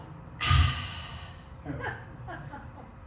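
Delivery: long, breathy sigh imitating grandmother; drawn-out acted "ahh" of a sigh; loud satisfied sigh. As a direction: sigh out loud after a good drink. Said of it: "she sighs out loud".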